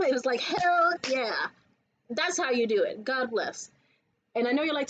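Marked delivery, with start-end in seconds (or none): none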